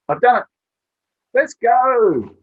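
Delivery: put-on very high, loud child's shout; triumphant little boy. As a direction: burst in like a proud, excited kid. Boy runs out, puzzle finished.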